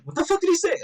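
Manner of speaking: high, mock outraged blurt; mock outraged yell. Teasing Slim in a mock indignant high voice. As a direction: pretend you didn't hear the insult. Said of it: "And I'd be like".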